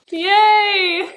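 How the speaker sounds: high, loud, tearful yay; joyful cheer. Real, not acted. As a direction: cheer with tears in the voice. Joy over matching books.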